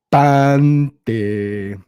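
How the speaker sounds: "Panty" drawn out in two long syllables; corrected "Panty". Shown as a demonstration. Comedian drills a commenter on the word, "Panty" loud and drawn out. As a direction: loudly stretch "Panty", landing clearly on the T. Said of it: "It's panty".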